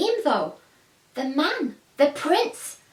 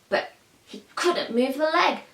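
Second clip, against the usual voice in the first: usual speaking voice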